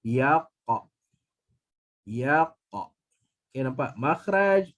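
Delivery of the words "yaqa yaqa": Yaqa with the qaf released at once; touch and let go on the qaf of Yaqa; crisp bouncing qaf in Yaqa; bounced qaf demo. Shown as a demonstration. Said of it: "jadi macam mana?".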